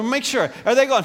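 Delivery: usual speaking voice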